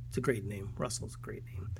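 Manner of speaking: a hushed, amused murmur; whispered aside. Real, not acted. Savouring the kid's name to the listener, whispered. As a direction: whisper it to yourself.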